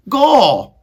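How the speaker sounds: put-on high, loud servant-girl voice; Meg's voice. Maid Meg answering Roscuro, high and loud. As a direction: squeak it out as the simple maid.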